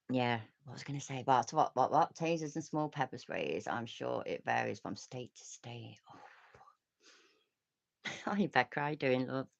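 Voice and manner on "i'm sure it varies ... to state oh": soft slow whisper; hushed reading of a chat message. Real, not acted. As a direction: whisper it slowly.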